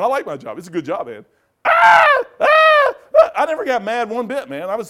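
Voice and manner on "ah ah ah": very high, loud imitated screams; mock scream. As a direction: shriek in exaggerated terror. Mock scream after hell line.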